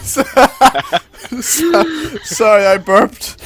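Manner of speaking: giggling through an apology; loud, helpless laughter on the words; laughing. Real, not acted. Laughing after a burp.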